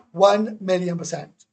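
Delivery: slow, loud, every word hit; slow, loud insistence on One; emphatic. Real, not acted. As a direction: drive One million percent home hard. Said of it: "emphatic claim".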